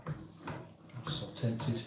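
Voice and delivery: slow, breathy whisper. Real, not acted. Confiding to chat his temptation, whispered slowly. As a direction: whisper it as a secret.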